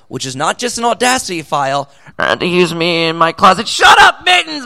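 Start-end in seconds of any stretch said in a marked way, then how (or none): 2.2-3.7 mock amateur recording. Mocking amateur voice actor recording. 3.7-4.7 high, loud mock yell at a cat. Amateur voice actor in a closet yelling at the cat, loud and high.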